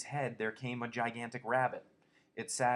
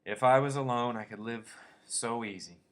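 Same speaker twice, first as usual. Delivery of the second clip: wooden; 8 dB louder than usual. A narrator's reading. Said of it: "He said woodenly".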